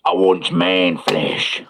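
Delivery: slow, stretched-out monster voice; orc voice. Acted. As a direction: drag out the words like a hungry orc. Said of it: "I think he spoke like that".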